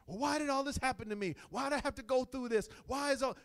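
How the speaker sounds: loud, high mock complaint; hammed-up plea demanding answers; acted protest. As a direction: cry out "why me" in protest. Acts out complaining questions to God.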